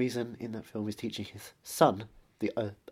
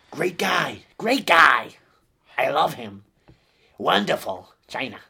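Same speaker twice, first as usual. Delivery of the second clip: high, loud blustery Trump voice; Donald Trump impression; 6 semitones higher, 12 dB louder, slower than usual. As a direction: boast like Trump at a rally. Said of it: "How is that guy still president?".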